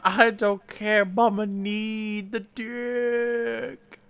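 high, drawn-out needy mock voice; imitated craving, very slow finish; hammed-up desperate woman. Exaggerated acted quote of desperate woman.

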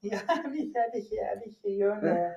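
high whiny refusal; high-pitched mock whining; Erlend Elias impression. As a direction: whine it out like a spoiled celebrity. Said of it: "Da sier jeg som Erlend Elias".

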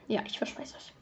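soft unvoiced whisper. Real, not acted. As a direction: whisper the promise. Aside promise to viewers.

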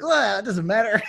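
nerdy nasal alien. Acted. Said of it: "my alien voice is totally just like a wicked nerdy guy".

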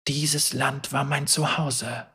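soft, slow, almost to himself; hushed inner thought. A narrator's reading. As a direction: think it aloud quietly, drawn out. Hero's inner thought, set apart.

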